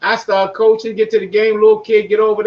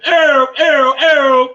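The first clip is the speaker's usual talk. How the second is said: calling out; 6 semitones higher, 6 dB louder, much slower than usual. Shown as a demonstration. Calling out to someone.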